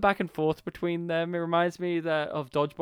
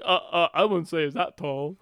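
mock shocked; 5 dB louder than usual. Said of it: "like, shocked".

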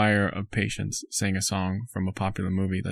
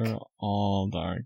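"They're all dark" said softer and slowly, drawn out; quieter, slow repeat; 8 dB quieter, much slower than usual. Real, not acted. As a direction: let it fade out slowly, almost to yourself. Repeats the remark twice.

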